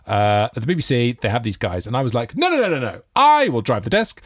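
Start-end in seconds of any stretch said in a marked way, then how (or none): 2.4-4.2 higher-pitched, stubborn mock refusal; insistent. Presenter imitating himself refusing the BBC desk team, insistent and high. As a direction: wave off all help, rapid no-no-no. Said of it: "I'd insisted on driving the desk myself".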